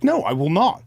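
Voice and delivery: defiant refusal. Retold or imitated. Refusing the voice in his head, loud and defiant. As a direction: slam the door on it, loud.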